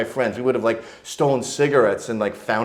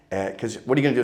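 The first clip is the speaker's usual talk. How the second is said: normal talking voice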